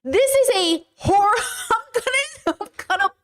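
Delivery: high-pitched, cracking into a laugh; furious disbelief tipping into laughter; outraged laugh. Real, not acted. Creator reacts to the texts, voice cracking with outrage.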